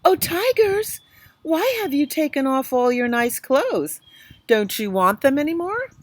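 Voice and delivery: calling out. Acted. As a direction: call across to them teasingly. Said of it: "called out".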